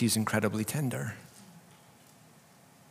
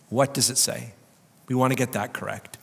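ordinary delivery

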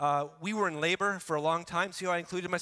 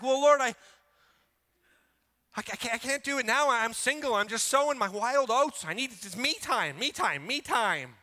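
whiny excuse; 5 semitones higher, 5 dB louder than usual. Retold or imitated. Pastor voicing a single's excuse to God, high and pleading. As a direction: insist you're too busy sowing wild oats.

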